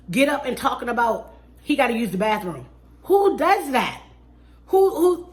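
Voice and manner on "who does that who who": much higher, louder incredulous who; incredulous shout; disbelief. Real, not acted. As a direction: cannot believe it, shouted. Ranting about bathroom walk-off.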